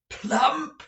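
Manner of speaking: high, loud appalled cry; the manager cries out in horror; horrified exclamation. A narrator's reading. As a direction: recoil at the very idea. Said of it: "exclaimed the manager, quite horrified".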